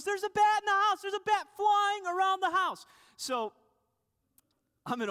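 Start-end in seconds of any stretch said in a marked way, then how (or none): 0.0-2.9 panicked wife. Retold or imitated. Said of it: "She goes".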